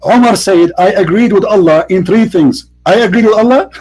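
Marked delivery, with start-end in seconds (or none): 2.8-3.7 higher, rising disbelieving question; amused disbelief, pitched up; incredulous echo. Real, not acted. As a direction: repeat it back as a scoffing question. Questions the quote, then laughs.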